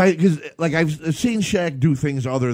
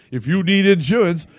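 ad parody voice; 6 semitones higher, 4 dB louder than usual. Pretend. Parodying [name]'s insurance ad.

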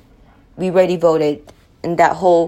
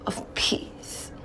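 words fade to a bare whisper; whispered wish for peace; breathy whisper; 13 dB quieter than usual. Real, not acted. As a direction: let it slip out unvoiced, softer. Prayer wish drifting off.

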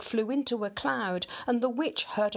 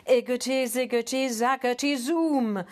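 chanted, louder magic words; casting a spell; 4 semitones higher, 4 dB louder than usual. A narrator's reading. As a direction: conjure it like a witch. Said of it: "she muttered a spell".

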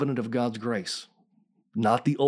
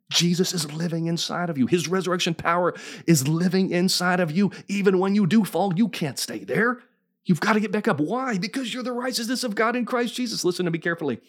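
raised, high-pitched preacher delivery; impassioned preaching; 7 semitones higher than usual. Acted. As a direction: preach it with rising urgency. Preacher builds to full force.

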